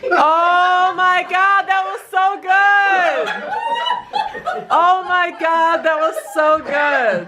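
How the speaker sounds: high, drawn-out, gleeful exclamation; real delighted reaction. Real, not acted. Friend reacting to a great joke, gleefully amused. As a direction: stretch each word out, high and amused.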